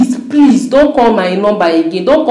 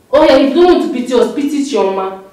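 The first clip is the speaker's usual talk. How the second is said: ordinary talk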